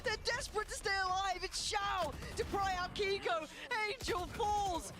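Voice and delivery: high, slow hype call; esports caster. Real, not acted. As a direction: build the play with rising tension.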